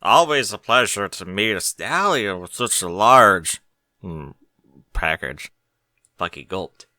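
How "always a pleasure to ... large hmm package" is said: a lightly voiced sly tease, with a suggestive pause; a touch of a saucy purr, dwelling on the innuendo; rakish innuendo. Berry teasing the stallion about his package, lightly sly. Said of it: "grinning rackishly".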